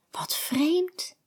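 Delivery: high whispered thought; thinking aloud. A narrator's reading. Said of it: "denkt ze".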